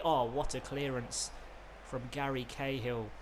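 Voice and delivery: ordinary talk, nothing marked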